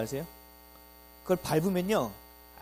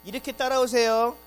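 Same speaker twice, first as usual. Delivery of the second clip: high, loud guide call; a guide herding tourists; mock tour guide; 5 semitones higher, 6 dB louder than usual. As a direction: lead the group along. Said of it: "강의한다니까요".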